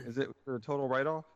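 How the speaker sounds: ordinary talk, nothing marked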